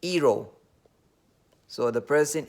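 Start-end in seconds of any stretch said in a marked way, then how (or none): 0.0-0.5 "hero" said without its H, as "ero"; western Nigerian dropped H. Shown as a demonstration. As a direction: drop the H from "hero", starting on the vowel. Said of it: "they don't know how to pronounce".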